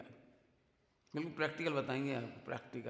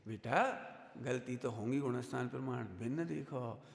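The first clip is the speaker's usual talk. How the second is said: calm teacher; 6 semitones lower than usual. Retold or imitated. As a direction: gently set the student straight. Teacher's calm answer to the student.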